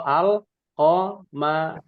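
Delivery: al-qamariyah drawn out syllable by syllable, the l of "al" clearly sounded; teacher's slow syllable-by-syllable al-qamariyah with clear l; sounded-out lam. Shown as a demonstration. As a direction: spell out al-qamariyah slowly, keeping the l. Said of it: "bacaannya ini sesuai dengan huruf".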